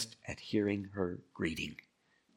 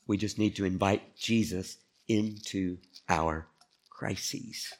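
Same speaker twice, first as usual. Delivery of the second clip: normal talking voice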